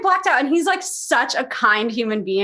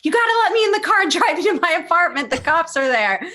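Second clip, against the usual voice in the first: urgent plea; 8 semitones higher, 3 dB louder, faster than usual. Retold or imitated. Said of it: "I'm like".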